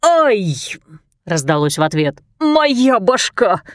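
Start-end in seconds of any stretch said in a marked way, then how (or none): 0.0-0.5 sharp pained yelp. Acted. Hit on the head. 2.4-3.6 put-on slow groaning drunk man; town drunk. Drunk man struck on head.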